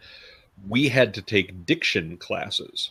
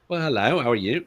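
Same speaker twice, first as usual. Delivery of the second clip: a light Cockney greeting, higher; lightly mirrored Cockney accent; half-joking Cockney hello; 3 semitones higher than usual. Retold or imitated. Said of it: "I know that's Cockney".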